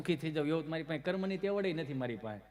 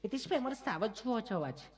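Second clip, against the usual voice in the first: lady visitor; 5 semitones higher than usual. Retold or imitated. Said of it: "એક બેન બોલ્યા".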